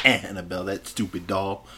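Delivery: ordinary delivery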